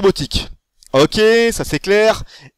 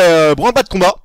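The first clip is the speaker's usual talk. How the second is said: ordinary delivery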